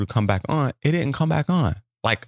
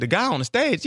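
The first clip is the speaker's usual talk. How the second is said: lightly mimicking the door lady; a hint of a confused door lady; high puzzled lady's voice; 9 semitones higher than usual. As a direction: repeat it back, puzzled and higher. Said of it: "She said".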